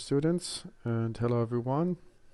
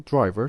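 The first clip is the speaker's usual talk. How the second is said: "driver" stressed on DRI; stress on first syllable; 8 dB louder than usual. Shown as a demonstration. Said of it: "stress in the word driver is kept on the first syllable".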